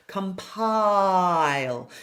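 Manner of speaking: compile with a long ah before the ee; lengthened vowel. Shown as a demonstration. Coach lengthens the first vowel of compile. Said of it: "So I'll lengthen the initial vowel".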